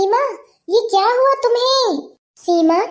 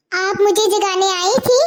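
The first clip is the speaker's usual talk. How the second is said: ordinary delivery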